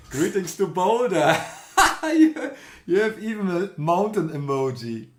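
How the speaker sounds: real laughter breaking into the greeting; laughing. Real, not acted. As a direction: chuckle the words out, amused. Amused by viewer's emoji.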